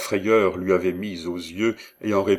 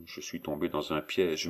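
softly murmured to oneself; low murmur; 2 semitones lower, 8 dB quieter than usual. A narrator's reading. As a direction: drop to a much quieter voice, as a trapped apprentice. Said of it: "murmura l'apprenti".

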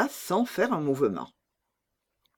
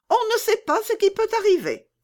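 much higher, loud and blunt; bold robber girl; 4 dB louder than usual. Acted. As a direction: toss it off like a fearless bandit girl. Robber girl answers Gerda.

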